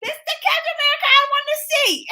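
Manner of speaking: high, shrill-free squealing fan voice, laughing; excited squeal. Retold or imitated. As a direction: gush like a thrilled fan. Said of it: "I was like".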